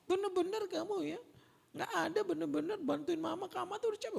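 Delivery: put-on tired mother scolding her child over the messy house; shrill scolding mother. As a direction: nag the child like a worn-out mother. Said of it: "saya omelin".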